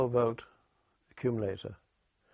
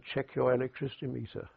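usual speaking voice

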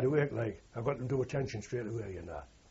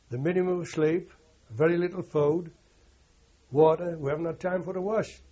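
pleading case; 5 semitones higher, 6 dB louder than usual. Retold or imitated. Sergeant pleads his men's case.

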